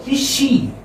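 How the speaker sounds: rising Is she? with a drawn-out she; teacher repeats the surprised question. Shown as a demonstration. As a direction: ask it surprised, holding the E. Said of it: "You say with surprise".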